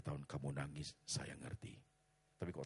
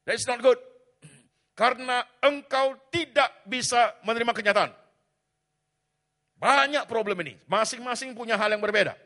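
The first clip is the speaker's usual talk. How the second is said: far higher, far louder preaching; full-force preaching; 20 dB louder than usual. Real, not acted. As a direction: preach with all your might. (khotbah dengan suara penuh)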